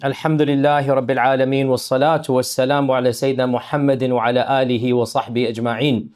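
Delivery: rapid, low Arabic invocation; opening invocation. Real, not acted. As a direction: recite the formula briskly in an even, low tone.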